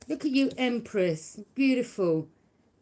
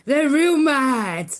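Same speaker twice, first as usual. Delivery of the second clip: mocking taunt; 4 semitones higher, 10 dB louder, much slower than usual. Real, not acted. Mocking angry haters.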